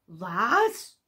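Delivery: loud, outraged cry; wütend. A narrator's reading. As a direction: shout it as the furious son. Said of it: "rief der Sohn wütend". (wütend gerufen)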